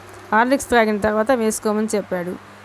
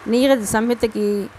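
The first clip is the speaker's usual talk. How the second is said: plain reading voice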